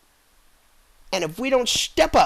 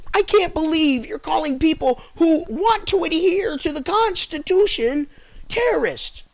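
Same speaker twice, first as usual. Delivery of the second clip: quoting the public, outraged and pitched up; indignant quote; 9 semitones higher than usual. Retold or imitated. Quoting upset people.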